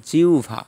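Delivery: জিহ্বা as "jiu-bha"; bh sound. Shown as a demonstration. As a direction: say জিহ্বা with u then bh. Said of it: "বয়ের স্থলে ভ হয়ে গেছে".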